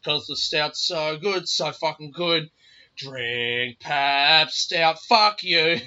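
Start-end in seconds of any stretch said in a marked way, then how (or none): 3.0-5.8 very slow, booming mock commercial voice; cartoon beer ad. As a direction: sell the stout like a cheesy ad. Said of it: "it sounds like an ad".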